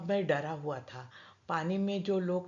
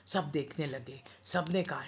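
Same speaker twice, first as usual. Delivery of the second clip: ordinary delivery, nothing marked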